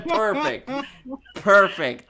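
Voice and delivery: laughing. Real, not acted. Laughing after funny answer.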